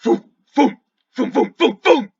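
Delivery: high, loud vocal booms; spaced booms, then a rapid burst; imitated vanishing pops. Game master imitating the party vanishing one by one, punchy sound effect.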